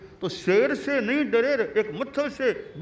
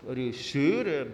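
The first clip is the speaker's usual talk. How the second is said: normal talking voice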